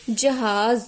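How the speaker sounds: "जहाज" as jahaaz, ending on a buzzing z; teacher's careful jahaaz for "जहाज"; z-sound demo. Shown as a demonstration. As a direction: model "जहाज" loudly with a clear final z. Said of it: "यहाँ पर ज की आवाज़ आ रही है".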